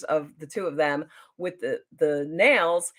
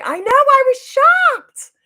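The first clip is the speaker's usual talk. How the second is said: very high, loud, drawn-out squeal; thrilled shock; 11 dB louder, slower than usual. Real, not acted. Fan answers comment about the star seeing her video, thrilled shock. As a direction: squeal it, stretching the words.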